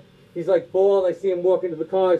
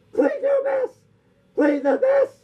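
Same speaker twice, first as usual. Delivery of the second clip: talking toy voice; slower than usual. Acted. Talking thumb toy speaks.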